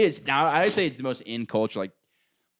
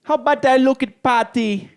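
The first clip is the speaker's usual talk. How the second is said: loud, high mock Jamaican voice; Jamaican accent; 12 semitones higher, 7 dB louder than usual. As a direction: belt it out in a put-on Jamaican accent. Launching Jamaican accent bit.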